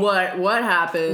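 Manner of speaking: loud, hammed-up confusion; mock bewildered boyfriend. Co-host joining in as clueless boyfriend, mock alarmed. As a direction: burst in with exaggerated alarm.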